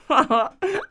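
short amused laugh. Acted. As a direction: burst out laughing. Ben lacht über Netz-Frage.

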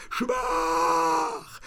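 eerie demon taunt. A narrator's reading.

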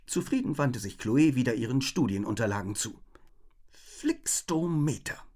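usual speaking voice

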